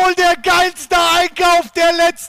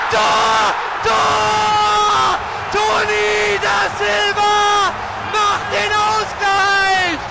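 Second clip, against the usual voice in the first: screaming goal commentary; 2 semitones higher, much slower than usual. Acted. Last-minute equaliser radio call.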